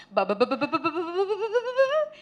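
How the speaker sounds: rapid nonsense syllables climbing in pitch; non-stop babble. Retold or imitated. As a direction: imitate someone chattering on without a break. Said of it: "he just goes non-stop".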